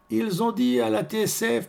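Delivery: high-pitched woman passing on news; chattering concierge. Acted. Concierge telling Orphée of the radio report, high-pitched.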